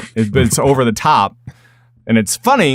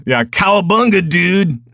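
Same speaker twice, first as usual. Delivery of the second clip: higher-pitched California surfer dude; California accent; 4 semitones higher than usual. Acted. Host mocking Californians back, California surfer accent. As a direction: put on a hammed-up California accent. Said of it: "There's the California accent".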